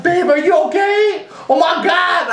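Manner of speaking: high concerned gasp; worried friend. Retold or imitated. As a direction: squeal it like an alarmed girlfriend. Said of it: "Maxine's like".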